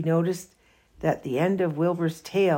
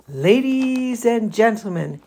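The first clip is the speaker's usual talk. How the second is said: announcer; 5 semitones higher, 6 dB louder than usual. Acted. Loudspeaker announcement at fair.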